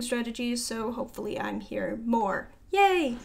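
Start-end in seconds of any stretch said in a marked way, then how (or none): 2.7-3.2 higher, louder "yay"; a bright burst of celebration; little cheer. Real, not acted. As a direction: give a short self-cheer. Cheers her hoped-for return.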